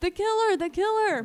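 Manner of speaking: imitated high, loud alarm cry; retold yell. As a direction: shout it like a panicked bystander. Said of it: "so she starts yelling this".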